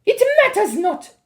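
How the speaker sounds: high, loud commanding witch; witch shouting. A narrator's reading. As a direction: dismiss it imperiously. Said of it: "shouted the Grand High Witch".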